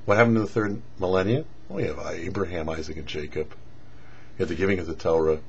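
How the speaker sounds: usual speaking voice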